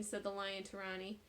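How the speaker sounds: usual speaking voice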